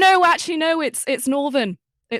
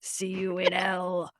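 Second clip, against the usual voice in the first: slow, hammed-up pirate; dying pirate, drawn out; slower than usual. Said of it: "And I come back to pirate voice?".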